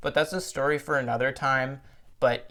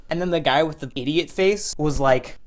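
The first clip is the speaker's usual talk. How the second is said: normal talking voice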